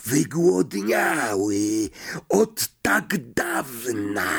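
icy, drawn-out disembodied threat; murderous monster voice. A narrator's reading. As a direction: stretch every word with ravenous hunger. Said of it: "morderczy szept".

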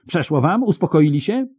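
very high, angry questions; high-pitched scolding questions; shrieking. A narrator's reading. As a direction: demand an answer in a high yell. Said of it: "wrzasnęła jeszcze głośniej".

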